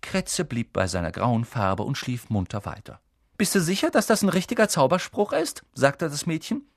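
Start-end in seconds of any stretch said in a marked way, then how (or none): 3.4-5.6 put-on high-pitched know-it-all girl; Hermine, sceptical. A narrator's reading. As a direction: question it with sceptical superiority.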